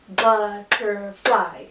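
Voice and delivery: "Butterfly" very slow and loud, but-ter-fly; three drawn-out beats on "Butterfly"; chunked into syllables. Shown as a demonstration. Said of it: "Three syllables".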